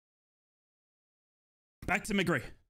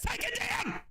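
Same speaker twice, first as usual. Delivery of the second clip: outraged shout. Real, not acted. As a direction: yell at the foul. Foul call.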